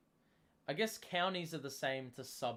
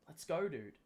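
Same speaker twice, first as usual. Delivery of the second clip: ordinary talk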